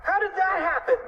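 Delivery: high-pitched baffled question; surprised cry. A narrator's reading. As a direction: ask it high in astonishment. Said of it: "cried Fluffy".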